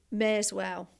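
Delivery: "may as well" squashed into "mairs well"; contracted Midlands "may as well", like "mairs well"; run together as one. Shown as a demonstration. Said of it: "what I grew up with is".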